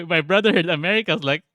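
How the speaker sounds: ordinary delivery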